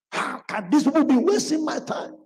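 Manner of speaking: high, exasperated grumble; annoyed complaint. Retold or imitated. Preacher voicing the annoyed man of God. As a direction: let the irritation spill out.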